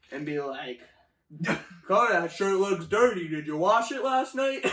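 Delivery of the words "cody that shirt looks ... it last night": loud, raised-pitch mock scolding; imitated nagging boss. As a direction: put on a pestering manager's voice. Voices boss nagging about shirt.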